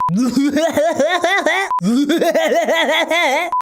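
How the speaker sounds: high, repeated rattling laughs; put-on exaggerated laugh. Shown as a demonstration. Voice actor recording laugh takes, exaggerated high laughter. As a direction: laugh absurdly on purpose. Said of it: "blooper reel of me laughing like that".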